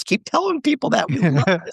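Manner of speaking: high-pitched, teasing egging-on; mock encouragement. Billionaire urging the author to keep spreading lies, mock encouragement. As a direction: imitate a tycoon gleefully egging someone on. Billionaire cheering on the foolish advice.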